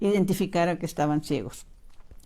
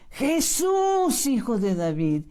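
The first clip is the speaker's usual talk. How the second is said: heartfelt cry; 4 semitones higher, slower than usual. Shown as a demonstration. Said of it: "gritarle, pero con el corazón".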